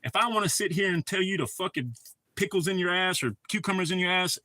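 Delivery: usual speaking voice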